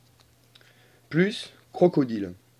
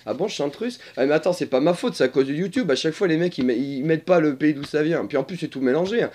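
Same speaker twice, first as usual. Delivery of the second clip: usual speaking voice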